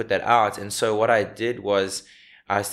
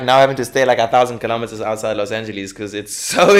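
usual speaking voice

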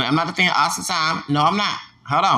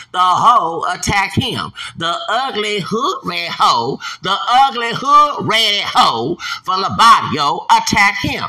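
slow angry rant; 4 semitones higher, 6 dB louder, slower than usual. Real, not acted. Ranting about officer attack.

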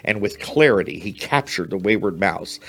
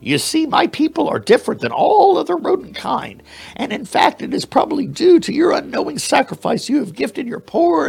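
very high squeaky mouse voice; proud mouse. Acted. Mouse inviting the bear to see the mouse city, high squeaky voice. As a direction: boast of your people in a tiny squeak.

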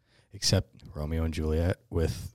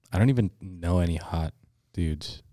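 ordinary delivery

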